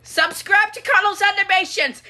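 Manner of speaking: high, loud announcement; full-volume cartoon shout; shouted plug. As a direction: yell it like a sudden advert. Shouted plug interrupts the scene.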